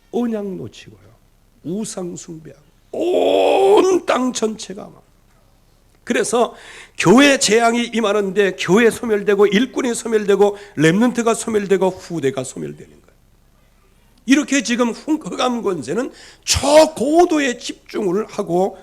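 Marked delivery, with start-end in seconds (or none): 1.6-5.0 slow, crying lament, higher; lamenting preacher. Acted. Preacher lamenting the idolatrous land, half crying. 6.0-18.8 loud, high, rising sermon cry; preaching at full force. Acted. Preacher crying out to the congregation about idolatry, loud and high.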